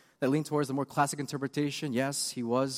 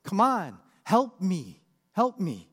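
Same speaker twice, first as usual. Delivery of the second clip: louder, higher pleading cry; a woman on her knees begging Jesus, pleading louder; desperate begging; 5 semitones higher, 5 dB louder, much slower than usual. Retold or imitated. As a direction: beg him for help. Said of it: "kneels down right before him and begs".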